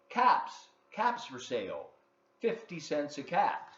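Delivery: a peddler hawking his caps in the street; peddler calling. Acted. Peddler calls to townsfolk, loud street cry. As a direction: call it out like a street seller. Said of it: "he called".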